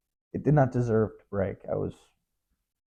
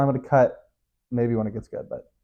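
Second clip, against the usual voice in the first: ordinary talk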